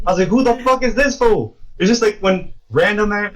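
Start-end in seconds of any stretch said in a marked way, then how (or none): 0.3-1.6 high, incredulous retold reaction; pitched-up dismissive question; mock disbelief. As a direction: scoff in baffled indignation. Retold baffled first reaction.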